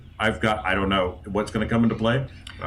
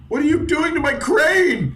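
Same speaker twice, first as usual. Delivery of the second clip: much higher, loud outraged cry; shrill dismay; 4 dB louder than usual. Acted. Crane owner crying out at the one wrecking his crane. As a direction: wail at the wrecking of your crane. Wood jammed into spinning gear.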